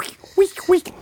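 very high squeaky critter voice; Molcar noise. Pretend. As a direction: squeak like a tiny guinea pig car. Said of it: "Please do your best impression of the Molcar noise".

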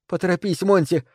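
light, high female call. A narrator's reading. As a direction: call out anxiously to hurry him. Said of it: "крикнула она ему".

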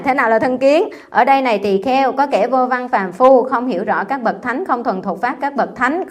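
solemn scripture reading voice; sutra recitation. Acted. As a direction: intone the sutra deliberately, word by word. Reciting sutra text to class.

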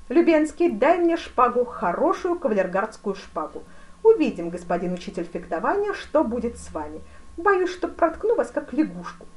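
loud, high imperious voice; великий князь, задиристый. A narrator's reading. (громко, задиристо)

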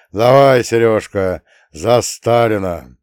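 loud, slowing toast; proposing a toast. A narrator's reading. Grandpa toasting with young Serёzha, loud hearty toast. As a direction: raise a glass with a drawn-out finish.

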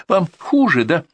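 high, slow, phony solicitude; fake concern. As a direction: coo with obviously false care. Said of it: "с фальшивой, как четырёхрублевая монета, заботой в голосе".

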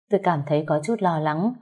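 ordinary delivery, nothing marked

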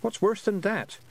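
put-on high-pitched questioning girl; Tiffany. Girl asking pixies about monsters, high and questioning. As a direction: ask it high, without the Scots accent.

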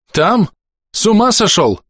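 high-pitched incredulous outcry; can't believe what he's hearing; ошарашенно. A narrator's reading. Doctor is dumbfounded. (возмущённое изумление высоким голосом)

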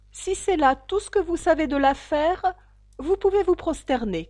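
high, loud royal voice; imperious king. A narrator's reading. The king lets the witness bow down, imperiously. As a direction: pronounce it from the throne.

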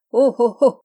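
loud, theatrical ho-ho-ho; hearty mock laugh. A narrator's reading. Narrator mimicking Yann's royal amusement, loud mock laughter. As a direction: read out a big belly laugh.

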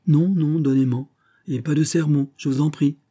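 high, loud, peremptory retort; sharp commanding tone with a harsh edge; imperious. A narrator's reading. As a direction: cut off the objection imperiously. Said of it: "répliqua Lily d'un ton impérieux".

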